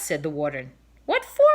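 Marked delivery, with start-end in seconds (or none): 1.1-1.5 high, loud astonished yelp; shocked protest; indignant question. A narrator's reading. Storekeeper reacts to arrest, high loud indignant yelp. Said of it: "asked the storekeeper".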